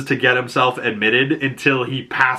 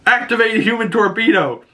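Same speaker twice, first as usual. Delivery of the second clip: mock battle cry; 11 semitones higher than usual. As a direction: shout it like a superhero move.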